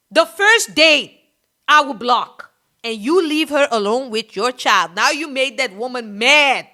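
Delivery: ordinary talk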